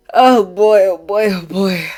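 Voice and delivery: loud, slow dread; dramatic dread. Real, not acted. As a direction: groan it out, dreading. Dreading the next character.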